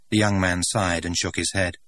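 ordinary delivery

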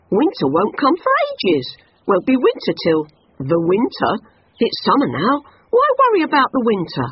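a happy-go-lucky insect teasing; carefree grasshopper. A narrator's reading.

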